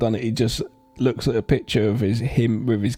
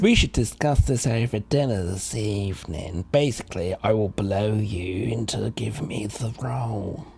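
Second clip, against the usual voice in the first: lower, seductive murmur that sinks to a whisper; sultry proposition; 4 semitones lower, 3 dB quieter than usual. Retold or imitated. Actress inviting the director over, low and seductive. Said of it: "she flaunts her boobs and says".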